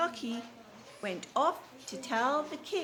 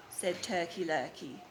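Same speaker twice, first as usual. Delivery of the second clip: ordinary delivery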